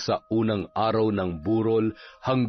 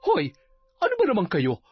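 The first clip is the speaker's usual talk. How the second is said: higher-pitched, reproving official; scolding captain; 10 semitones higher, faster than usual. Acted. Captain scolding gossips at the wake, reproving. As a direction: rebuke the gossips indignantly.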